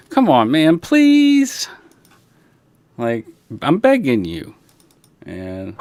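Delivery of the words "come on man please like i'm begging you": begging dog. Pretend. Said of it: "That's the".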